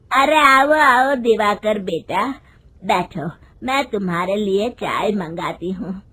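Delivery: put-on loud, slow old mother-in-law calling; a matriarch calling to her daughter-in-law. Mother-in-law welcoming guest and calling for tea, loud slow.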